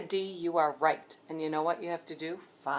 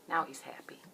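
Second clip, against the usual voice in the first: whisper; 2 semitones lower, 9 dB quieter than usual. Acted. Soft aside to children.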